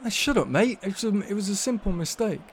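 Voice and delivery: the keeper answers Tony, shrugging it off; defensive keeper. A narrator's reading. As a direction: brush it off as nothing. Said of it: "said the keeper, shaking his head".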